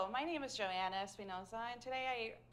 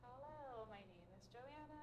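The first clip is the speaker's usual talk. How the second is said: low, soft, chest voice; student trying a lower pitch on request; 14 dB quieter than usual. Shown as a demonstration. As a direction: drop it into the chest. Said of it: "feel that lower pitch in your chest".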